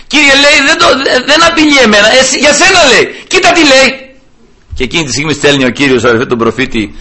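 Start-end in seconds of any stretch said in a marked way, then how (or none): 0.1-4.2 high-pitched, insistent pleading; urgent appeal. Acted. King tells God the threat is against Him, urgent appeal. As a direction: tumble into a protest to God, voice lifted high.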